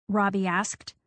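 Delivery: ordinary delivery, nothing marked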